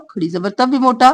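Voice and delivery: normal talking voice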